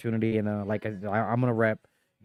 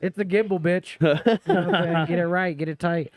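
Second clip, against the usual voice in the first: cocky correction; 6 semitones higher than usual. Retold or imitated. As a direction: set them straight with swagger. Mock correcting a doorman.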